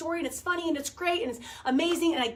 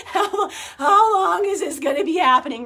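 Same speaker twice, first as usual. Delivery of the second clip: husband's question, laughed through and rising louder; laughing exasperation; 4 dB louder than usual. Retold or imitated. Imitating her husband, laughing at the endless wait, laughing complaint. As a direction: giggle while mock-complaining. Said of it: "And John's like".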